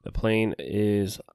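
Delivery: usual speaking voice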